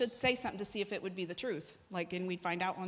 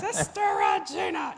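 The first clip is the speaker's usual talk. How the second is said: loud, high mimicked holler; Mark Dunphy; 9 semitones higher, 5 dB louder, slower than usual. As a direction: holler it slowly, way up high, like a revivalist. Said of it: "You know how he talks".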